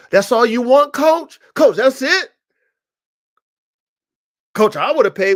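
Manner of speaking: loud, high-pitched disbelief; mock astonished buyer.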